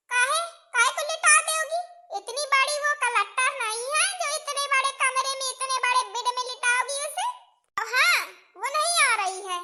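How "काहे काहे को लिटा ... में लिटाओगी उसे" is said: a higher, scolding mother-in-law voice; bossy mother-in-law. Acted. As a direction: lay down the law like a village mother-in-law.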